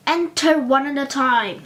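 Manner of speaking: loud, sharp command at the door; barked order. A narrator's reading. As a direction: bark it like a stern guard. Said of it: "he barked".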